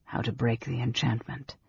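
low, soft realization; finished softly. A narrator's reading. Raistlin finishing her sentence, soft and low. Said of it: "Raistlin finished softly".

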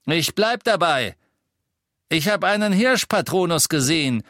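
high, loud insistence; calling out. A narrator's reading. First Death Eater sticking to his story, calling out. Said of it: "rief der erste Todesser".

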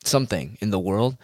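normal talking voice

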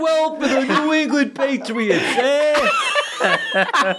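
high, hammed-up Boston jock; Boston accent. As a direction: play Tom Brady with a broad Boston accent. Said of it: "Tom Brady doesn't have a Boston accent".

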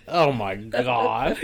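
slow, drawn out through laughter; laughing disbelief. Retold or imitated. Reacting to the kid in the ad, slow and laughing. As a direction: groan it while cracking up. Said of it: "And I'm just like".

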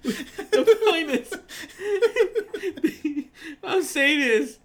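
high, slow words broken by laughing; talking through laughter. Real, not acted. Explaining his point to a friend, helplessly laughing. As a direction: try to make the point while cracking up.

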